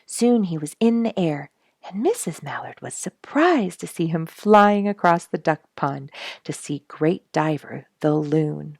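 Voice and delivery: ordinary delivery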